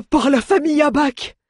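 high, loud horrified oath; horrified cry. Acted. Dwarf swears in horror at his whitened beard. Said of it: "s'écria-t-il, horrifié".

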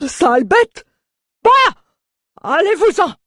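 high, loud dwarf yells of disgust; startled cry. A narrator's reading. Dwarf spotting rats in the tunnel, high disgusted shouting. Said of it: "poussa un cri en apercevant des rats".

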